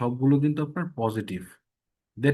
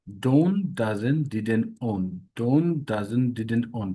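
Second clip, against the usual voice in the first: rhythmic run of negatives, "don't" leading each round; repetition drill; 2 semitones lower, much slower than usual. Shown as a demonstration. Teacher drilling for ease by repeating, rhythmic recital. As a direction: chant "don't, doesn't, didn't, own" as a set. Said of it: "বারবার পড়ে".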